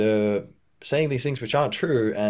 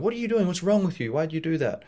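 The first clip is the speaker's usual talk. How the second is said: mock confrontation; 9 semitones higher than usual. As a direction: demand answers in a sharply raised pitch. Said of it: "instead of being like".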